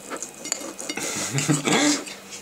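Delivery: breathy giggle; quiet high-pitched chuckle; soft high laugh. Real, not acted. Host laughs at own joke.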